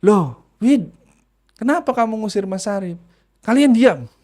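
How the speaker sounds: high, startled parent's question; confused parent then spirit. Retold or imitated. Parent asks Widi why she drives him out, startled. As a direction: ask in alarm, voice up. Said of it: "Pak Widodo dan istrinya pun bingung".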